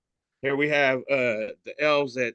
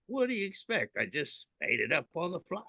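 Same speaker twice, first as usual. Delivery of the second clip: higher, quieter smug reply; smug mite; 5 semitones higher, 5 dB quieter than usual. Retold or imitated. Mite defending its pun, smug and quiet. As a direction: deliver the punchline pleased with itself. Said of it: "And the mite says".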